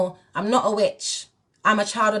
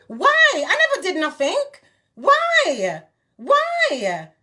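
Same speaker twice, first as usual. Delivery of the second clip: indignant cry; 7 semitones higher, slower than usual. Real, not acted. Speaker to her accusers, high indignant outburst. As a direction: fling the question up in outrage.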